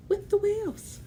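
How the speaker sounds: squeal. Acted. Monster falls into the water.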